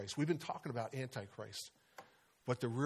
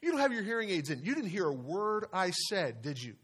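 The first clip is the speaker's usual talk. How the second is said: higher, exasperated realisation; surprised wife; 6 semitones higher, 4 dB louder than usual. Retold or imitated. Preacher voices wife realising husband can't hear her. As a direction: catch him out indignantly. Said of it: "finally looked at him and realized".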